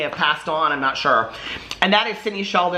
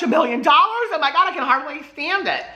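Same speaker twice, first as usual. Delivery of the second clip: mock thrill; 10 semitones higher than usual. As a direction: play up the thrill of the blurb as a joke. Teasing herself over the book's offer.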